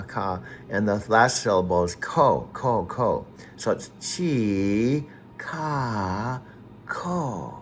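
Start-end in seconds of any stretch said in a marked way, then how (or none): 3.6-7.6 chi, ka, ko split into slow separate syllables. Shown as a demonstration. Teaching a friend to say the name, slow syllables. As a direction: spell out chi, ka, ko slowly. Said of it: "Three syllables".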